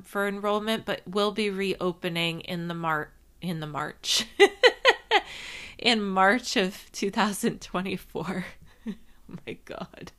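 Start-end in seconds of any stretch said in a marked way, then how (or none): 4.3-5.7 high sudden laugh; burst of laughter. Real, not acted. Laughs at own slip. 8.9-10.2 whispered laugh through words; laughing under breath; breathless laughing. Real, not acted. Laughing at own stumble.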